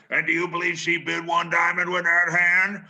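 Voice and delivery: indignant Bradlee. Retold or imitated. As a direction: scoff at the wife's bid.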